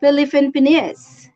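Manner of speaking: Philippine Penis said as pi-li-FIN PEN-is; mockingly mispronounced. Vlogger laughingly imitating a TikTok mispronunciation. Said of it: "he pronounced Philippines".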